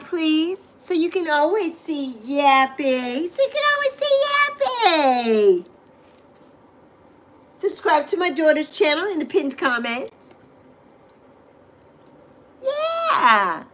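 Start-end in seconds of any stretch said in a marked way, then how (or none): 3.3-5.6 much higher, cutesy squeak; squeaky high voice. Shown as a demonstration. Repeats line in new voice. 12.6-13.6 high cheering shout; excited yeah. Real, not acted. Cheering viewers on.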